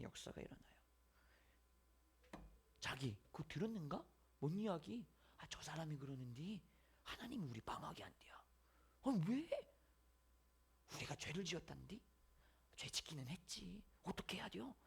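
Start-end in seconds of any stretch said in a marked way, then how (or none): 2.8-14.7 softly gossiping villagers, hushed and secretive. Acted. Nineveh townsfolk whispering the news to each other, hushed. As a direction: pass the rumour along under your breath.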